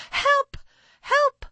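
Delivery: very high, piping cries for help; frantic mother duck cries; panicked calling out. A narrator's reading. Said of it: "she called out".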